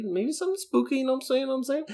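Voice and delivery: high, teasing spooky tease; hint at it with a grin; suggesting a spooky October pick, playfully. Real, not acted.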